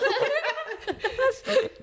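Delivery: squealing laugh; high laugh. Real, not acted. Laughing at the doctor story, high giggle. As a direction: let a high giggle burst out.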